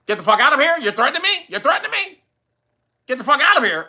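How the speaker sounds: high, incredulous repeated you're threatening me; hammed-up disbelief; mock outrage. Convict scoffing at the officer's threat claim, mock outrage. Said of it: "And he was like".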